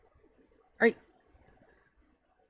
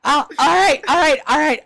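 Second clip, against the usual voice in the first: loud; 6 semitones higher, 6 dB louder than usual. Real, not acted. GM player shouting to stop.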